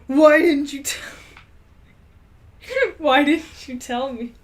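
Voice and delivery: loud, slow hammed-up shout; mock angry shout breaking into laughter. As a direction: yell it at her, laughing through. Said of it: "he shouted".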